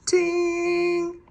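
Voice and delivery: high, ringing ting held long; a high bell chime. Acted. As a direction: sing it out like a bell. Bell sound imitated.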